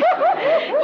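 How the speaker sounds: loud high squeal of laughter; burst of laughter. Acted. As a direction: shriek with laughter. (luide hoge gierlach)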